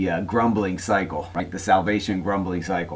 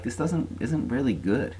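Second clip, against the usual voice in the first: soft, higher-pitched grumble; mock complaining; 5 semitones higher, 5 dB quieter than usual. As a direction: imitate a quietly dissatisfied complainer. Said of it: "They started complaining".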